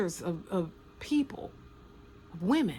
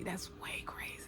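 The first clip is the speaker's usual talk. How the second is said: whisper; 13 dB quieter than usual. Real, not acted. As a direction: breathe it out, shocked. Shocked aside.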